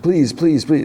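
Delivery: high-pitched retold begging; repeated plea, pitched well up; mock pleading. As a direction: imitate a kid begging for help. Slightly begs Smee for help.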